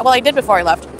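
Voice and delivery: ordinary talk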